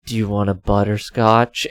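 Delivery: lightly voiced awkward man, quiet and low; imitating weird man. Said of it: "when he's like".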